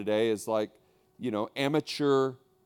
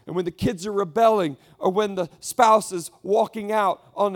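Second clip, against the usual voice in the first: higher, louder, proclaiming; preaching at full force; 8 semitones higher, 7 dB louder than usual. Acted. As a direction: drive the hope home. Preaching climax.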